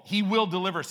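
"will" pressed hard, not "able"; stress on will. Shown as a demonstration. Preacher stresses "will" in God's promise, emphatic. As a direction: lean on "will" in "he will deliver us". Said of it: "Not just able".

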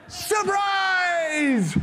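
high, loud, drawn-out "surprise" shout; imitating a thrilled little boy; excited kid shout. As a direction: burst out like a child springing a gift. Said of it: "and he goes".

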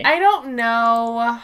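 slow, stretched I don't know; drawn-out shrug. Real, not acted. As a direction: drag it out in mock helplessness. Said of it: "repeats it differently".

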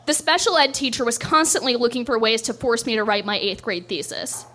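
normal talking voice

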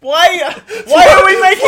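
high loud crying-laugh protest; laughing outcry. Real, not acted. Mock-outraged at a friend, wailing laughter. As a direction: wail through laughter in disbelief. Exasperated at the anecdote.

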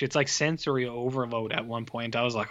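normal talking voice

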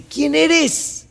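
high-pitched startled cry; acted alarm, voice jumping up; startled question. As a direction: call out to an unknown voice. Climber startled by a voice.

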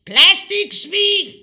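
shouted insult. Retold or imitated. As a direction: bellow the insult at her. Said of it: "som havde råbt". (naboen råber skingert og langsomt skældsord)

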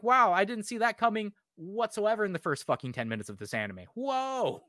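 hammed-up sarcastic wonder; sarcastic fake surprise. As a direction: gasp as if shocked at the obvious twist. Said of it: "I'm like".

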